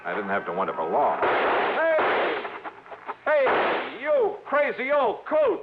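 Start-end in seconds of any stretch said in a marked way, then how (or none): 1.2-5.6 calling out. Acted. Johnny hails the old coot across the yard, shouting. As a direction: shout it out to the old man at the gate.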